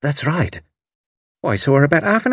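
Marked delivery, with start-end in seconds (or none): 0.0-0.6 high, eager confirmation. A narrator's reading. Missus Sprott confirms to the servant, eagerly. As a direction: jump eagerly on the answer. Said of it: "Missus Sprott said eagerly".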